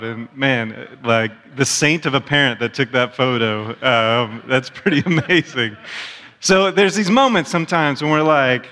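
ordinary talk, nothing marked

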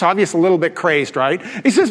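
ordinary talk

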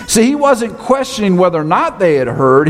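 usual speaking voice

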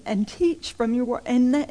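normal talking voice

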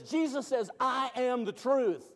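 loud, high preaching proclamation; preaching at full force. Acted. Preacher answering the confused with Jesus, loud.

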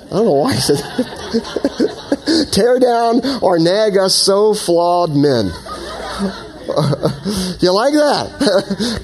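cracking up while reading on; laughing. Real, not acted. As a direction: laugh through the words, high and drawn out. Cracks up at own joke.

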